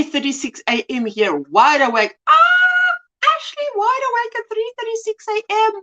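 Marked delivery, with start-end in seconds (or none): 2.3-5.7 exclaimed disbelief. Real, not acted. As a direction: wail in astonishment at the early hour. Mock dismay at viewer's early hour.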